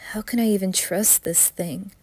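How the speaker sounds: ordinary delivery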